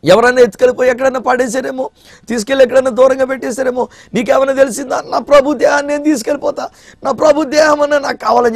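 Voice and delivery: high, pleading woman's plea; pleading Mary. Acted. Mary begging the gardener for her Lord's body, high and pleading. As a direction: beg the gardener with rising urgency, pitched high. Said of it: "అడుగుతుంది".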